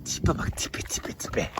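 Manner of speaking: soft doting whisper. Real, not acted. Man whispers over his foal's face, soft doting whisper. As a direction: hush it like a secret to the foal.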